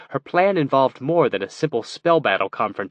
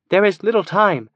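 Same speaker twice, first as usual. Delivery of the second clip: a touch louder, urgent protest; 8 semitones higher, 5 dB louder than usual. A narrator's reading. Kiva protests to the elf scout, mildly urgent. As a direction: push back with some urgency. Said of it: "Kiva protested".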